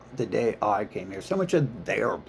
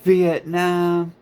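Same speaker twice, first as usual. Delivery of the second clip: mocking whiny veteran; 8 semitones higher, 4 dB louder than usual. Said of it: "have to call me up and be like".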